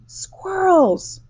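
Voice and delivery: high, loud excited shout. A narrator's reading. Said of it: "shouted Veruca Salt".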